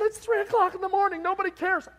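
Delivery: high, loud protest; husband, exasperated at his wife doing her makeup at 3am. Retold or imitated. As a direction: throw up your hands at the hour.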